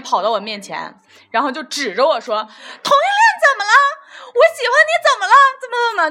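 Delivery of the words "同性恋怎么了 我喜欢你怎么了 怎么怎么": much higher and louder, defiant outburst; loud accusing shout. Retold or imitated. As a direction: yell it at him in a public scene. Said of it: "就特别大声指着我".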